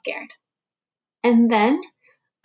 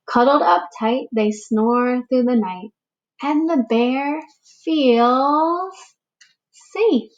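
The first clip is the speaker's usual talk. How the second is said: put-on slow, expressive read-aloud for young children; storybook reading; 2 semitones higher, much slower than usual. A narrator's reading. Teacher reading a picture book to the class, gentle storytelling. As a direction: read it like story time for little ones.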